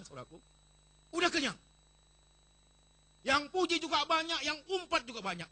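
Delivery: high, loud preaching at full force; fiery preaching. Acted. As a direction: preach it out to the whole church.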